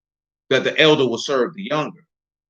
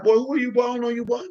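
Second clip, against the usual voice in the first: higher, scornful brush-off; dismissive Lord; 8 semitones higher, 4 dB quieter, faster than usual. Retold or imitated. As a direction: wave off a stranger at the door. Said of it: "He like".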